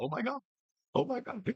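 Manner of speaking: high, surprised mock gasp; playful mock surprise; mock shocked waking. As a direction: pretend to wake up stunned. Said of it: "was like".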